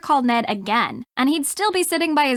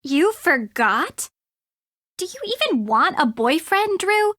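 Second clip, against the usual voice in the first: higher-pitched, scolding disbelief; incredulous scolding; 5 semitones higher than usual. Acted. Friend chiding Drew over a forgotten call, incredulous. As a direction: draw out the shock, then challenge them.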